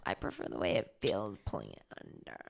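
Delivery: low, soft, sultry mimicry; mock sexy imitation. Partner echoing a toilet paper preference, mock sexy. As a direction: tease by echoing it in a deep sultry voice. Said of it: "I meant it sounded sexy".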